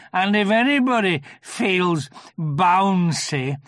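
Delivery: slow, loud, aggrieved donkey; Eeyore. A narrator's reading. Eeyore explaining his fall in the river, slow and aggrieved. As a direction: lay out the grievance ponderously.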